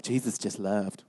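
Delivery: low, soft, slow mock sigh; mock naive voice. Mocking people who say Jesus just loved, low and soft. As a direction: mimic the naive crowd softly. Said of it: "People are like".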